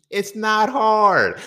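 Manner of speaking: loud, high, drawn-out words; exasperated shout. Real, not acted. Podcaster fed up with research logic, exasperated drawn-out shout. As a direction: stretch it out loudly in disbelief.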